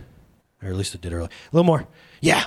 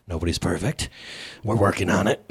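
sheepish mumble; 11 semitones lower, 6 dB quieter than usual. Retold or imitated. Mock excuse to judging wife.

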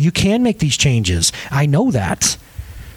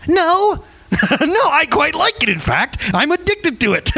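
laughing mock confession; 10 semitones higher, slower than usual. Mock answer to doctor, laughing.

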